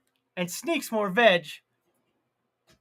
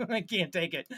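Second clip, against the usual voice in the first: normal talking voice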